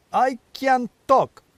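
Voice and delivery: slow, high, tartly offended retort; with asperity. Acted. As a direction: snap it back, a little put out. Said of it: "said the head with some asperity".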